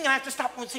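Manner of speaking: ordinary talk, nothing marked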